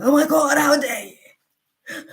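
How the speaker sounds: imitating an offended man, hammed-up; a slow drawn-out mock how dare you; mock outrage. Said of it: "He was like".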